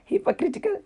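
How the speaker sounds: "Hypocritical" high and soft, rising at the end; improved pronunciation attempt. Shown as a demonstration. Retrying the word she thinks is better, tentative. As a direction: try out "Hypocritical" lightly with a questioning lift. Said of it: "I think that's better".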